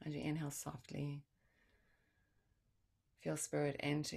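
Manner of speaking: guided meditation. Acted. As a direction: ease them into stillness.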